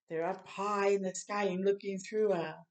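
ordinary delivery, nothing marked

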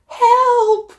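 high, loud scream for help; cry for help. Acted. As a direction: yell it as the dragon looms. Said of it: "cries the witch".

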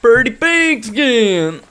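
slow, high, loud southern drawl; southern accent. Retold or imitated. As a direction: savour each word like the swamp man. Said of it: "I love the southern accent".

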